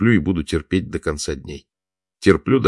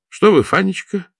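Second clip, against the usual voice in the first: surprised; 14 semitones higher, 4 dB louder than usual. A narrator's reading. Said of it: "удивился Охлопков".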